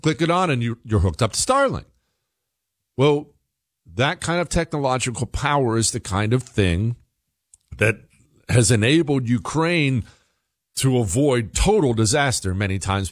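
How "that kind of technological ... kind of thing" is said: usual speaking voice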